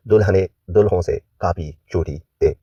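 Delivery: ordinary delivery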